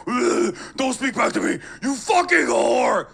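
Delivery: high, loud, raging yell; mock a furious male host screaming; hammed-up angry shout; mock angry macho. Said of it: "They're like".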